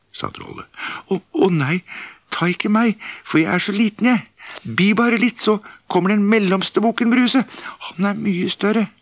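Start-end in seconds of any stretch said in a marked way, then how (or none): 1.1-8.9 very high, small and pleading; a frightened little goat begging; tiny goat voice. Acted.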